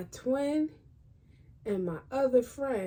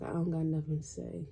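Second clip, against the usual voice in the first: sullen; 6 semitones lower, 6 dB quieter, faster than usual. Retold or imitated. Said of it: "And I was just like".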